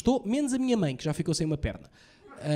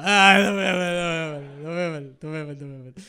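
put-on drunk father slurring; 4 dB louder than usual. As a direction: slur it like a proud drunk.

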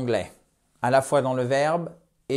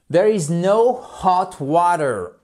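slow English line, stress on no; slow model sentence; 3 semitones higher, 4 dB louder, slower than usual. Shown as a demonstration. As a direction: model it slowly for the class. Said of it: "Voyez comment en anglais américain". (phrase lente pour l'élève)

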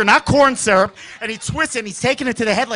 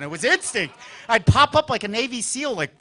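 ordinary talk, nothing marked

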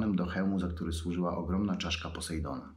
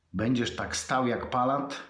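higher, louder mocking yell; shouted taunt; 6 semitones higher, 3 dB louder than usual. A narrator's reading. Atra shouts at his brother, mocking yell. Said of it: "krzyknął Atra".

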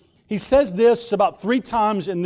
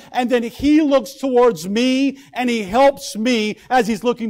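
louder, higher fervent preaching; full-force preaching; 3 semitones higher, 3 dB louder than usual. Acted. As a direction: preach it out to the congregation.

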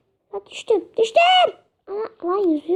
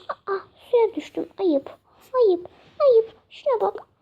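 ordinary delivery

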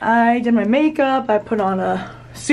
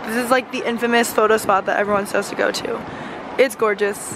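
ordinary talk

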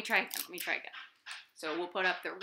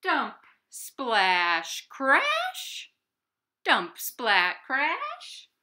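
truck crash noise; 3 semitones higher, 6 dB louder, much slower than usual. Acted.

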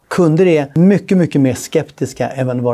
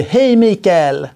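higher, slow drawn-out friendly hello; chummy greeting; 5 semitones higher, much slower than usual. Retold or imitated. Salesman greeting Mikael, drawn-out and chummy. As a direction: stretch the name like an old pal. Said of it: "När någon säger".

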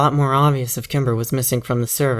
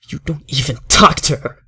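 angry; 3 semitones higher, 6 dB louder than usual. Acted. Father's threat rising.

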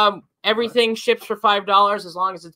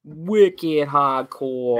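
very slow, drawn-out Boston "wicked hahdcoah"; mock Boston accent; 6 semitones lower, much slower than usual. Host joking about a Boston colleague in a mock Boston accent. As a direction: stretch it out like a hammed-up Bostonian. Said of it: "Tom Brady's got a real Boston accent".

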